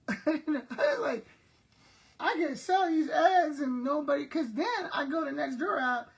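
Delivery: laugh-cry through the words. Real, not acted. Laughing hard at egg money.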